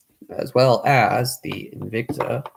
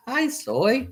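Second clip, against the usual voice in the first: talking to a dog. Real, not acted. Greeting the dog.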